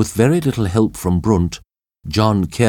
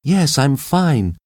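high, slow female answer; high reassurance across a distance; woman calling back; 8 semitones higher, slower than usual. A narrator's reading. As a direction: call back high and drawn out. Trapped Kathy calls back.

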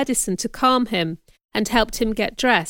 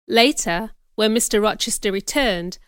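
ordinary delivery

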